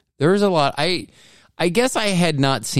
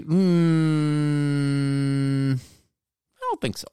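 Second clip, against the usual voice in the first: drawn-out dubious "mm", dropping to a small voice; skeptical; 5 semitones higher, much slower than usual. Acted. People reacting to him carrying Bobby's body, skeptical. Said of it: "people would be".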